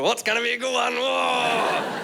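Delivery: high, excited mock cheer; hammed-up excited listener; eager partygoer. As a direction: whoop in expectation of a joke. Acted partygoers expecting a joke.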